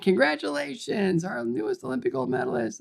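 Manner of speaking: mock-grand hail to a new champion; hearty congratulations. TV boss greeting the new gold medalist, hearty congratulations.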